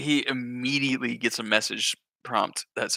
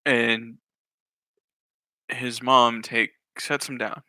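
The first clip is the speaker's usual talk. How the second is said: normal talking voice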